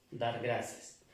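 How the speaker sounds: ordinary talk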